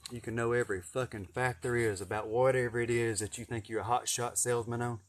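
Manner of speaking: ordinary talk, nothing marked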